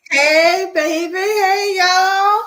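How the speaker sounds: put-on high, sung-out New Orleans hey baby; New Orleans accent. Co-host showing off her New Orleans accent when asked, high and sung. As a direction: lay on the New Orleans drawl for the crowd. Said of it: "you gotta use your New Orleans".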